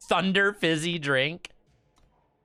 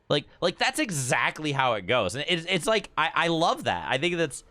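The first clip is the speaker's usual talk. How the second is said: ordinary talk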